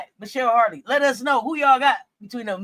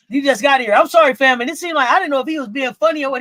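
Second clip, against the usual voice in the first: excited retelling; 3 semitones higher, 7 dB louder than usual. Real, not acted.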